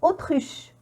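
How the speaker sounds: Autruche opening on a pure "o"; AU pronounced O. Shown as a demonstration. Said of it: "mais on prononce O". (Autruche, « au » prononcé « o »)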